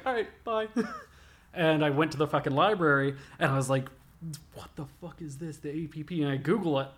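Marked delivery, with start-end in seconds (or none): 0.1-0.7 high, sheepish little goodbye; meek quick exit. Retold or imitated. 4.5-6.2 puzzled to himself. Retold or imitated. Retold puzzled thought.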